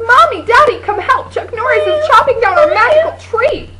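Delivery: high, loud alarmed calling to mom and dad; a girl shouting for her parents about the tree. Acted. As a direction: rush in crying for help, breathless with alarm. Girl runs to parents for help.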